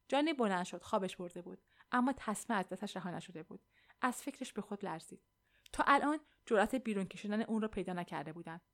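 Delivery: plain reading voice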